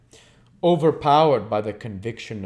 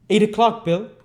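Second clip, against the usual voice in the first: much higher, louder woman's voice; Nancy's voice; 13 semitones higher, 9 dB louder than usual. Acted. Nancy tells Bill the bell struck eight, raised. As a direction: call out the hour to Bill.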